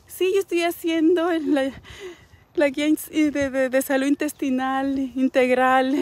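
high, mocking put-on voice, slowing at the end; mock yourself pretending to follow the health guide; mock sanctimonious self. Mocking a hypocrite who tells viewers she follows the guide, high put-on voice. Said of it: "Y luego venite a decir a ti".

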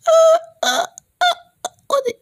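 very high loud startled yelps; panicked squeal. Acted. High-pitched game character yelping in panic. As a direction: squeal in fright.

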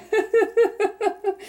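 high bright laugh of joy; happy laugh. Real, not acted. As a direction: let out a gleeful laugh. Laughs, happy about the find.

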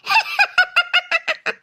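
high, shrieking ghost laugh; wicked witch laugh; evil cackle. Acted. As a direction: cackle over her screams. Ghost mocks her cries.

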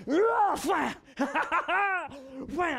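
hammed-up chopping yells; mock effort shouts. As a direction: bellow each axe swing. Imitating axe swings on ice.

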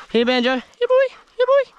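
high sing-song dog call; calling a lost pet. Real, not acted.

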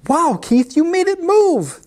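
high, wowed cat voice; gasped amazement. A narrator's reading. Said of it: "they gasped".

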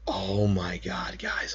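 slow awed whisper; breathless whisper. Real, not acted. Whispered after catch.

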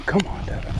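cheering. Real, not acted. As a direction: urge him on. Cheering a friend's catch.

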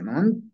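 mamam said "ma-MAN"; dropped ending. Shown as a demonstration. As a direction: lose the whole tsik ending in mamam. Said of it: "la K se pierde".

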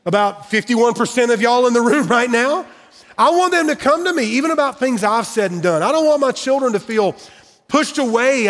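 rising pulpit intensity; preaching. Acted. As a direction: preach it to the room with conviction. Impassioned sermon appeal.

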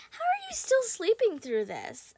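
ordinary talk

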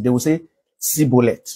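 Sibolet said with a plain s, no sh sound; mock mispronunciation. Preacher mimics the Oyo people saying Sibolet. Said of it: "they can't pronounce it".

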